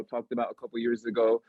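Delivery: ordinary talk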